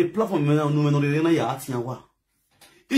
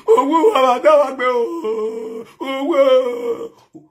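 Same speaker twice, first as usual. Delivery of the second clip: mocking wail; 6 semitones higher, 4 dB louder, much slower than usual. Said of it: "live video and be shouting".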